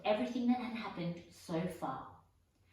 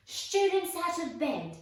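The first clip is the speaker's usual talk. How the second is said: very loud, much higher bellow; 11 semitones higher, 8 dB louder than usual. Acted. Peeves bellowing to alert the castle, very loud. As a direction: raise the alarm at full voice. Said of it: "Peeves bellowed".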